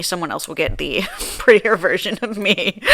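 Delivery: usual speaking voice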